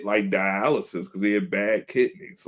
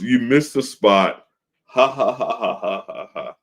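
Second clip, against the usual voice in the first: mocking boss laugh; 3 semitones higher, 4 dB louder than usual. Boss mocking him at the door.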